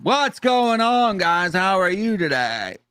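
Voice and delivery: high, slow goofy voice; silly character voice. Pretend. Davies impression on video call.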